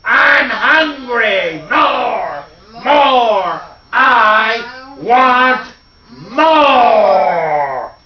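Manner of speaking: high, loud, drawn-out giant's bellow; big ringing voice. Acted. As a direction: let each word ring out across the village. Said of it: "his big voice rang out".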